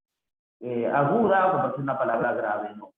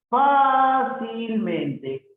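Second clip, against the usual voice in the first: "fácilmente" long, high and loud on "fá"; stretched syllables; 8 semitones higher, 5 dB louder, much slower than usual. Shown as a demonstration. Teacher sounds out word for accent. (alargando las sílabas, empezando agudo)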